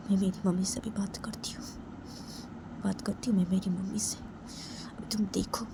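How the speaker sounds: quiet, ominous promise to a hurt friend; hushed simmering resentment. Acted. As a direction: speak under your breath, holding anger back. Simmering at mother on friend's behalf.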